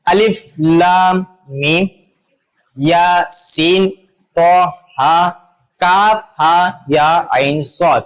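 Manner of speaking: slow, drawn-out recital of letter names, each apart; letters read separately. Shown as a demonstration. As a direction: stretch "لام" and "میم", pausing between letter groups. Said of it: "جو الگ الگ پڑھے جائیں".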